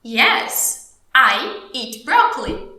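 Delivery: slow, deliberate teacher English; slow model answer. Shown as a demonstration. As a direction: answer slowly, spacing the words. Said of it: "Como que você me responde isso?". (resposta-modelo lenta e pausada)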